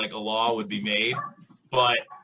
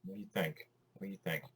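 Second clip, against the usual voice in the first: much softer, cooing to a pet; soft talk to a dog; 2 semitones lower, 14 dB quieter, much faster than usual. Real, not acted. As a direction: hush it gently to the dog. Asking the dog softly.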